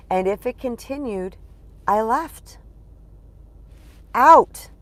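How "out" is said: a sudden loud, final "Out"; emphatic out. Real, not acted. Telling listeners she walked away, loud and final. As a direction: slam the door with one loud word.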